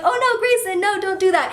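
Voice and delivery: put-on high, urgent scolding of a toddler; alarmed mom. Mom pleading with toddler Grayson, high and alarmed. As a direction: stop the child from wrecking the bouquet.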